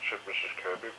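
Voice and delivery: ordinary delivery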